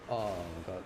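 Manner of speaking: usual speaking voice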